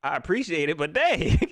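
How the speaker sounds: ordinary talk, nothing marked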